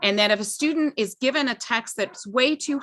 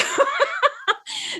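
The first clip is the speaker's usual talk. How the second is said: embarrassed laugh. Real, not acted. Embarrassed after Zoom mishap.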